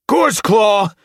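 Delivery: high, loud summons; calling out. A narrator's reading. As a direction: call sharply for someone outside. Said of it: "Splashtail called through the entrance".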